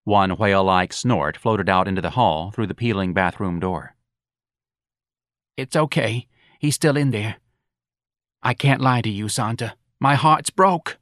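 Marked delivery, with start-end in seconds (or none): 5.6-11.0 high, plaintive character voice; heartbroken mother. Acted. Mrs. Riley confessing to Santa, plaintive. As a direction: confide the trouble, lifting into a lament.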